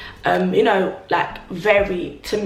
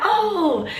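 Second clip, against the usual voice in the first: pleased surprise; 10 semitones higher than usual. Retold or imitated. Flattered by a compliment.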